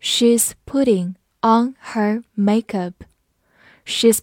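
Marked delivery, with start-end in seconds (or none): 0.0-2.9 slow, word-by-word model sentence; slow read-through. Shown as a demonstration. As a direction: separate each word for the learners. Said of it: "好，慢慢来读".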